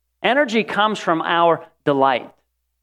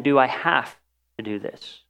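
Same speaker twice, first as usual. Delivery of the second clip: grudging question; 4 semitones lower, 5 dB quieter than usual. Shown as a demonstration. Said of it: "There is a strong difference between saying".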